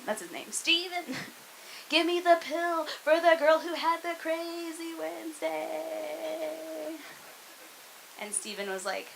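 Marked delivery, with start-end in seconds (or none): 0.5-7.0 high, soft, drawn-out plea; showy flirtation. Acted. Flirty request acted at pharmacy counter.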